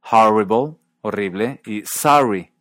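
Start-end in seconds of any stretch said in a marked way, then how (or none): 0.1-0.8 English "horrible" as harrible; open vowel. Shown as a demonstration. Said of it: "El primer sonido aparece". 1.9-2.5 "sorry" as sahry; open vowel. Shown as a demonstration. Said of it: "El primer sonido aparece".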